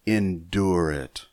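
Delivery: Endure it said slowly with weight on Endure; slow down and lean on Endure; stress on endure. Shown as a demonstration. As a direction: land on Endure, deliberately and slowly. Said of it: "Don't miss that last word".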